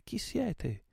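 high, hailing challenge; thundering shout. A narrator's reading. Captain challenges unknown ships, high calling shout. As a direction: call the question across the water, high. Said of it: "gridò egli con voce tonante".

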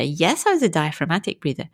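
normal talking voice

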